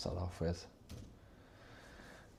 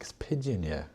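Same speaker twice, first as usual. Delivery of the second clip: put-on south London accent; 4 semitones higher, 4 dB louder than usual. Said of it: "You'd be like".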